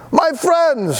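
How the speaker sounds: high, ringing salesman greeting; a bright pitchman's hello; clear keen announcer. Acted. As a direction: call out like a showman. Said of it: "he announced in a voice clear and keen".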